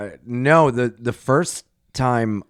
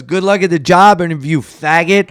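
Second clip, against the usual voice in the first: mocking taunt; 4 semitones higher, 8 dB louder than usual. Inmate taunt retold.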